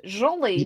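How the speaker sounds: accented "jolly", zh for j; Russian-sounding jolly. Shown as a demonstration. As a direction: say "jolly" as "zholly", Russian-tinged. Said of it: "that's Russian".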